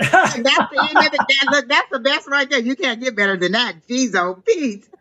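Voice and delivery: high-pitched, elated agreement; excited. Real, not acted. Reader agreeing the reading can't be beaten, excited. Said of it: "I am so excited".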